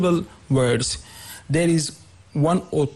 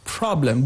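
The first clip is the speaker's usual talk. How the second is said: "problem" with PROB stressed; first-syllable stress. Shown as a demonstration. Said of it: "the first syllable has a stress".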